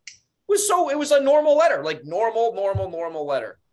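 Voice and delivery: high, loud disbelief; exasperated. Real, not acted. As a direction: protest that the letter was normal. Exasperated about voicemail.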